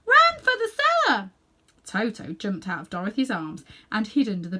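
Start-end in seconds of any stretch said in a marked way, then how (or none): 0.1-1.3 much higher, loud frightened scream. Acted. Aunt Em screams at Dorothy to flee, frightened. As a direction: scream at the child to hurry. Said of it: "she screamed".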